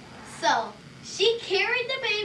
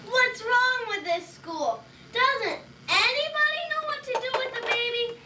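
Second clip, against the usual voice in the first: Benjamin voice; 7 semitones higher than usual. Acted.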